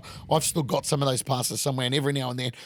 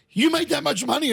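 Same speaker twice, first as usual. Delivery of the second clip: amazed; 12 semitones higher than usual. Retold or imitated. Dad amazed at the earnings.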